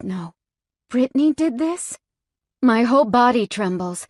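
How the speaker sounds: plain reading voice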